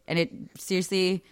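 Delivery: usual speaking voice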